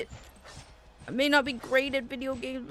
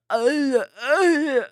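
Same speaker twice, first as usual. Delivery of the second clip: ordinary delivery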